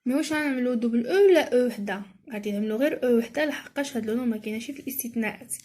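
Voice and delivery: ordinary talk, nothing marked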